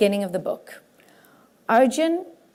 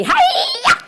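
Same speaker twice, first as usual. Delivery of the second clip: very high, loud squealing hi-ya; Miss Piggy karate yell; 6 dB louder than usual. Retold or imitated. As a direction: shriek it like Miss Piggy chopping. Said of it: "And I'm Miss Piggy".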